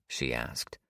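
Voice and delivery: ordinary delivery, nothing marked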